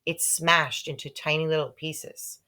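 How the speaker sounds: plain reading voice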